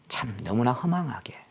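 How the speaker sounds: ordinary delivery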